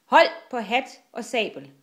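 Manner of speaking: loud call to the crew; shouted warning. A narrator's reading. Killer to crew in the storm, shouting. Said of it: "råbte Kli".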